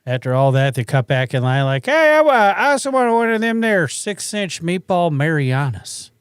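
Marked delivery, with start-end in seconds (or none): 1.9-6.1 dim customer voice, a bit higher; hammed-up rude customer ordering a sub; mocking a customer. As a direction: imitate a clueless guy cutting back in line. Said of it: "That's a quote".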